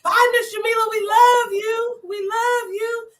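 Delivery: put-on high, slow, sing-song children's goodbye; imitating children saying bye. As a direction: kids calling out their love at the door. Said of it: "And when they leave".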